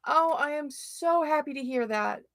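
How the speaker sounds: ordinary talk, nothing marked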